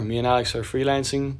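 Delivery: ordinary delivery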